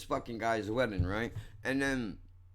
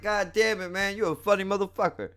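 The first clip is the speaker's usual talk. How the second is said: much higher, quick admiring exclamation; impressed fan; 9 semitones higher, 3 dB louder than usual. Retold or imitated. Audience member praising the comic, high impressed fan. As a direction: gush it in a high put-on voice. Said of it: "everybody says it just like that".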